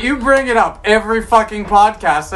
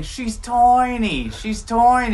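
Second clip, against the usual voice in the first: slow, drawn-out mock Aussie "tiny" as toiny; 3 semitones higher, 3 dB quieter, much slower than usual. Retelling the Aussie girls teasing a small woman, slow mock accent. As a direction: imitate the Aussie girls' taunt, slowly.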